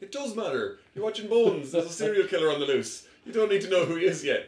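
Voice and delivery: high, loud mock-exasperated telling-off. Daughter arguing with mum over DVD order, exasperated.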